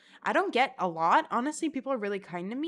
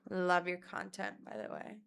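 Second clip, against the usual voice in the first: judgy understated compliment; 6 dB quieter than usual. Retold or imitated. Girl judging her TikToks, unimpressed delivery. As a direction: drop it like a backhanded compliment. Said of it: "Oh my God, it was".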